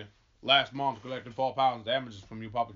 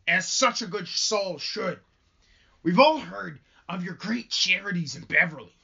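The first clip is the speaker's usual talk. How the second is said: higher and slow, overly gracious; theatrical Hale; 5 semitones higher, 4 dB louder than usual. Acted. Hale flatters Rebecca Nurse, slow and high. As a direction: lay the courtesy on thick. Rereading Hale's line correctly.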